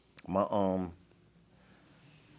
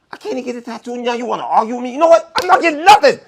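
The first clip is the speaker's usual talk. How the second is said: much higher and louder, laughing into it; mock angry outburst; 14 dB louder, faster than usual. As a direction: yell at her, cracking up at the end. Retold argument with girlfriend.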